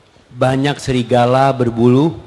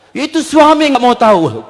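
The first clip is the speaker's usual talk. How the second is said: high, louder-edged mock grievance; complaining woman; 8 semitones higher, 4 dB louder than usual. Wife grumbling to the ustadz, high-pitched complaint. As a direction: imitate a wife protesting about her husband. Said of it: "Perempuan mengatakan".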